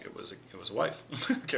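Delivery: usual speaking voice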